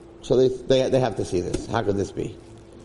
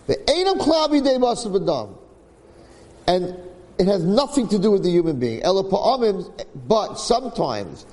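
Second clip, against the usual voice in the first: higher, emphatic sermon reading of a holy text; fervent text reading; 4 semitones higher than usual. Acted. Rabbi reading the holy text aloud to his listeners, higher and emphatic. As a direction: read it out to the congregation with fervor.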